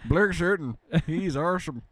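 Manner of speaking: put-on mumbling Texas Southerner accent; mock mumbling accent. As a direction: ham up a lazy Texas mumble. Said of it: "I love talking like this".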